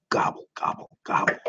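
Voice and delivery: soft unvoiced worried gobble; whispered gobble. Turkey fretting to himself, whispered. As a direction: breathe it out fearfully.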